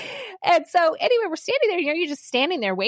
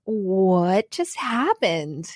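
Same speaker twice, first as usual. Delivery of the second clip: very slow, lowered, dazed; stunned disbelief; 6 semitones lower, much slower than usual. Retold or imitated. She recalls getting to the car, slowly stunned. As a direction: draw it out in retold bafflement.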